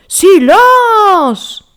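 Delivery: very high, loud furious roar; bear's roar. Acted. Bear roaring at the mouse to be silent, loud. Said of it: "rugit l'ours".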